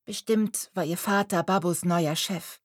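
ordinary delivery

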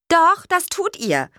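slow, high, loud-edged retort; not so polite. A narrator's reading. Annoyed witch answers her uninvited visitors, not so politely. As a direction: answer rudely, drawing it out. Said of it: "nicht ganz so höflich".

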